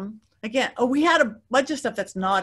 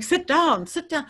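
higher, clipped mock command to sit; mock yell; 7 semitones higher, slower than usual. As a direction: imitate the host shouting an order, lightly. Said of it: "Zach's yelling at me".

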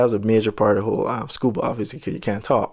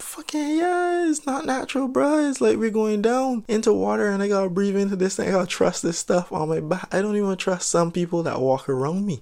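hammed-up panic; 7 semitones higher than usual. Diver arguing that breathing underwater is unnatural, hammed-up panic. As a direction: start way up high in mock disbelief, gradually settle.

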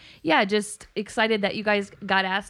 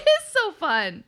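gushing; 7 semitones higher, 4 dB louder, much slower than usual. Real, not acted. Gushing about the show.

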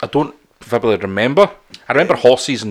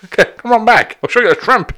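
high-pitched shady showman; Dick Dastardly; 11 semitones higher, 3 dB louder than usual. Acted. Co-host luring punters round back, sketchy villain voice. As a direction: lure them in like a cartoon villain. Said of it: "trying to go like Dick Dastardly".

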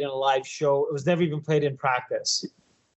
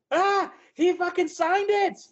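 high, slow fanboy squeal; ecstatic fan; 13 semitones higher, slower than usual. Retold or imitated. Fan to bass player about signature, ecstatic. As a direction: squeal it out, losing your mind. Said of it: "You know, like that".

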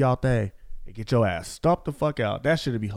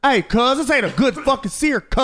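Snoop as chef; 10 semitones higher, 5 dB louder than usual. Retold or imitated. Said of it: "Snoop as chef complaining".

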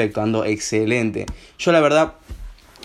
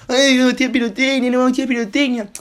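much higher, whiny fussy aunt voice; mocking fussy aunt; 10 semitones higher than usual. Mocking the aunt who bans fireworks, high whiny voice. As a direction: parody a preachy aunt banning fireworks.

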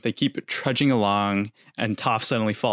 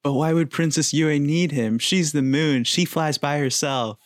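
higher, dopey mock Sokka; goofy Sokka voice; 6 semitones higher than usual. Imitating Sokka's dopey moon logic, higher and goofy. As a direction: play it like a clueless joker. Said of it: "And then Sokka is like".

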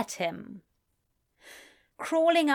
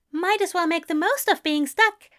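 cheerful gentle voice; 8 semitones higher, 4 dB louder than usual. A narrator's reading. Aziraphale voiced in narration.

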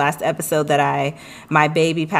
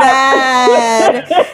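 usual speaking voice

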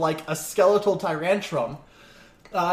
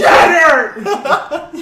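shouted order; 10 semitones higher, 12 dB louder than usual. Acted. GM yells order.

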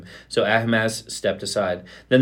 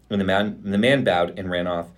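ordinary delivery

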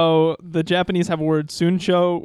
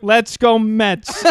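put-on slow, loud New York accent; 5 semitones higher, 7 dB louder, much slower than usual. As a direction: drawl it like a Mets fan. Continuing New York bit.

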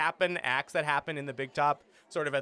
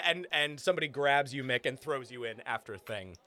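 ordinary talk

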